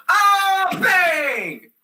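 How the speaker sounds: high, loud yell ending in a bang; scream, then shout the crash; imitated charging scream. Imitates Burma charging into wall.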